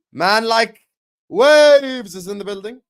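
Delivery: loud, high hype call; big hype shout for a viewer entering. Real, not acted. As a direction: announce him like a hype man. Hyping a viewer's arrival.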